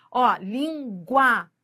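"língua" drawn out slowly, sound by sound; slow demo. Shown as a demonstration. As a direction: stretch "língua" so the glide is heard. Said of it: "Você viu?". ("língua" bem devagar, mostrando a semivogal)

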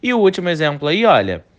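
usual speaking voice

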